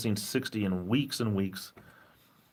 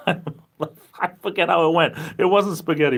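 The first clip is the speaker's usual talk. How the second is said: high, loud and laughing; real laughter running into the words; 8 semitones higher, 5 dB louder than usual. Real, not acted. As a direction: talk through a laugh. Cracks up over muddled saying.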